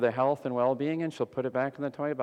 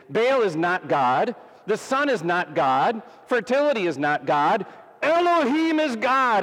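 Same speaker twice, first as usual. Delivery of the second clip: emphatic proclamation; 8 semitones higher, 5 dB louder than usual. Acted. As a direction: hammer home that only Elohim is God. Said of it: "to tell them emphatically".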